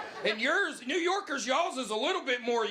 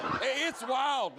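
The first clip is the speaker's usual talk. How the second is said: ordinary talk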